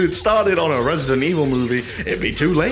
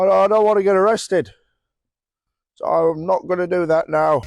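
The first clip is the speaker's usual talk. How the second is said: higher, halting off-the-cuff confession; awkward aside; 6 semitones higher than usual. Real, not acted. YouTuber explaining an abandoned joke, awkward halting aside. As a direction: stammer through it, raised voice with long gaps.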